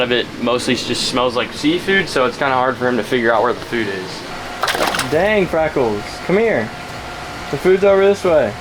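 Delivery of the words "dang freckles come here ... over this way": talking to fish. Real, not acted. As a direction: call her over to the food. Calling the stingray to food.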